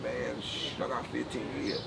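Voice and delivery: low hushed voice, almost a whisper; hushed aside. Retold or imitated. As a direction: keep it down so nobody hears. Telling inmates his sentence quietly.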